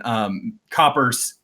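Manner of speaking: usual speaking voice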